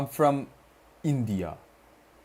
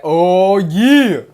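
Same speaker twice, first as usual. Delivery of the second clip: excited shout; 8 semitones higher, 15 dB louder than usual. Real, not acted. Streamer reacts to chat.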